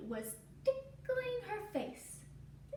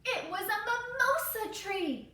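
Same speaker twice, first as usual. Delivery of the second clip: higher, bright announcement; excited reveal; 8 semitones higher, 4 dB louder than usual. A narrator's reading. Storyteller revealing the tree with delight. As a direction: burst out with the discovery.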